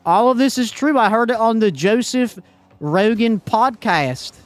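loud, high-pitched incredulous rant; mock conspiracy believer. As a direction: play a worked-up podcast-fed alarmist. Plays a ranting conspiracy believer.

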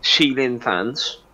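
ordinary talk, nothing marked

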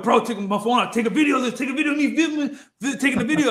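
giddy with the find, laughing as you ask; excited high voice telling a friend to film; thrilled retold request.

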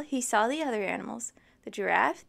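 plain reading voice

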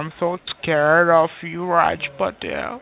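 mocking fear. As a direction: pretend to be scared, dragging out each word. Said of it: "Are you mocking me?".

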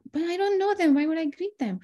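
high, incredulous pitch jump; baffled protest. Retold or imitated. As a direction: protest in disbelief that you should greet strangers. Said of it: "I was like".

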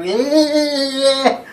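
loud high whoop out the car window; whooping yeah. Retold or imitated. Driver with head out the window, loud whooping yeah. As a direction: holler it like singing along to the radio. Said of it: "stick my head out the window and go".

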